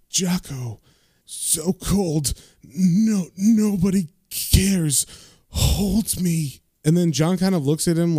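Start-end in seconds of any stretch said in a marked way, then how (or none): 0.1-6.6 halting, ghostly moan with drawn-out words; Jocko. Retold or imitated. Dead homeless man begging John to hold him, ghostly moan. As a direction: groan it slowly like a freezing ghost. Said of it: "And then the man says".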